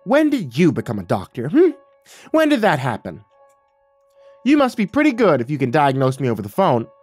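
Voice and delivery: offended mom, sarcastic and pointed; outraged snap. Acted. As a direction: snap back with mocking outrage. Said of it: "made an outraged sound in her throat and snapped".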